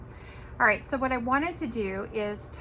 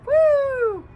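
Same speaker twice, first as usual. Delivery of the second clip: high, loud woo; long, gleeful party cheer; excited whoop; 10 dB louder than usual. Real, not acted. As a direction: whoop along to a favourite song. Cheers along to her song.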